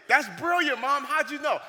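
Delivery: loud, high-pitched flattery; mock amazement. Son flattering mom's wrong guess, mock amazement. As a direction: gush at Mom as if she's a genius.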